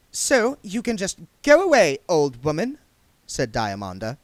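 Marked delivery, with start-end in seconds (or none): 0.1-2.8 high, loud, cold dismissal; dismissive young witch. A narrator's reading. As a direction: send the old woman away. Diamanda dismissing Granny.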